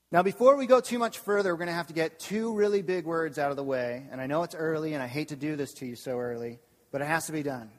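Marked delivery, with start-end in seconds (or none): none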